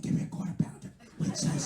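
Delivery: put-on hushed, breathless drunk repeat; drunk girlfriend whisper. Girlfriend repeating her order, whispered and drunk. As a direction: whisper it like she's too drunk to care.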